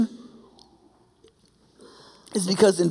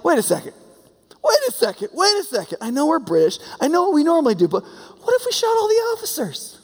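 much higher, hammed-up dawning realization; mock sudden idea; 9 semitones higher than usual. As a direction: act out the scheming rebel. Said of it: "You'd be like".

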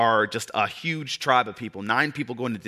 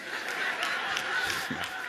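soft breathy laugh; 10 dB quieter than usual. Real, not acted. Laughs at own joke.

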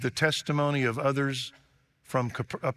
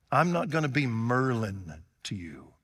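normal talking voice

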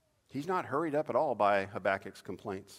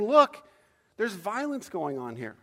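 urgent call; 8 semitones higher, 7 dB louder, slower than usual. Acted. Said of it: "I'm saying".

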